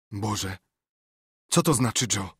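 a panicked cry for an answer; choked out. A narrator's reading. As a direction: gasp it out in dazed terror. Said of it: "wykrztusił".

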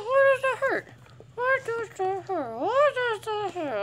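high whimpering whine; whiny mock hurt. Real, not acted. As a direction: drag out the complaint. Repeating pained question.

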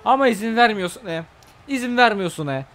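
loud, high exasperated complaint; loud annoyed rant at the game; frustrated yell. Real, not acted.

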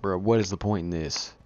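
ordinary talk

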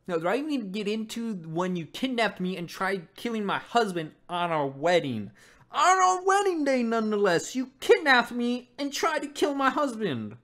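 rising outrage, high and loud; furious daughter calling out her father; angry daughter. Acted. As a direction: confront him, voice climbing. Reacting, voicing angry daughter.

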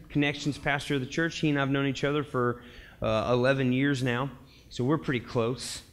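ordinary talk

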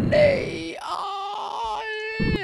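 dismayed cry. Real, not acted. Helicopter crashes in game.

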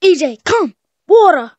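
high, loud barked command to a pet; mock command. Playing pet owner ordering E J, loud and high. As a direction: call the pet like a trainer. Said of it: "what if I just say".

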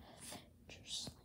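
breathy whispered echo. Acted. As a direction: whisper the move name. Whispered echo of move name.

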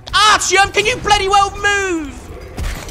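slowed-down furious holler; exasperated shout. Real, not acted. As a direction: bellow at him to get out of the way. Shouting at stuck companion.

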